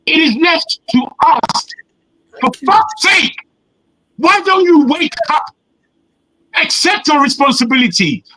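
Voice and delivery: high, exasperated shouting at the panel; real shout. Real, not acted. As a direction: yell it up high, fed up. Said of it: "I'm sorry to shout".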